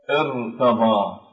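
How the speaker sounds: ارتضى said slowly with a thin, light ra; light ra demo. Shown as a demonstration. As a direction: draw out ارتضى and keep the ra light. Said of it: "الكسر أصلي في القراءة".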